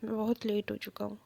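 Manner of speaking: quietly apologetic, lightly voiced; husband apologizing softly to his injured partner; emotionally. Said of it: "एकदम emotionally कहता है".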